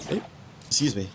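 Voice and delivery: hiccuping; hiccups. Real, not acted. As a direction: talk through the hiccups. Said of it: "Still got the hiccups".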